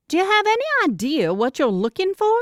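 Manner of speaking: lightly voiced clinic receptionist asking. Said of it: "the receptionist ... asks".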